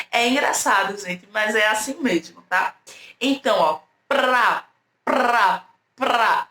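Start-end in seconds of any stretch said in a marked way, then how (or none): 4.1-6.5 "pra" repeated alone, both consonants audible; encontro bem articulado. Shown as a demonstration. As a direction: sound out "pra" with a distinct rolled R. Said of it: "Eu pronuncio um pouquinho". ("pra" repetido com pausas, cada consoante audível)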